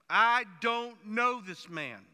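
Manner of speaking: loud and very slow, every word of "I don't know this man" spaced out; drawn-out oath. Pretend. Peter imagined swearing before God, loud and very drawn out. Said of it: "probably saying something like".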